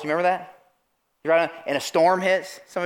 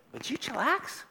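soft, higher-pitched soothing question; calm reassurance; 7 semitones higher, 9 dB quieter than usual. Acted. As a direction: gently talk the panicked down. Calm Jesus answers panicked followers.

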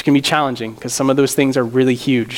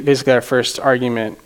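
usual speaking voice

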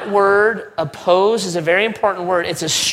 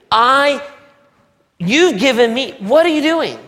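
high, loud spluttering protest at his father; indignant outburst; 8 semitones higher, 5 dB louder than usual. Acted. Older brother sputtering at his father in outrage. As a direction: sputter in disbelief at Dad.

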